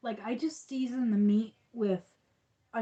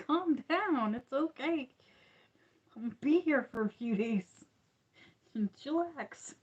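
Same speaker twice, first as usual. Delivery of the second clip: higher, coaxing pet voice to the dog; soothing an excited dog, pitched up; talking to the dog; 5 semitones higher than usual. Retold or imitated. To her shaking dog Toby, higher coaxing pet talk.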